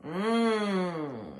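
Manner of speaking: loud hum fully in the nose; nasal hum. Shown as a demonstration. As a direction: hum straight through the nose. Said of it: "which is the N G sound".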